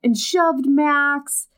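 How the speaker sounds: high, slow, over-the-top alarmed voice; hammed-up alarm, pitched high; mock panic. Abby bursting in to warn the aunt, hammed-up alarm.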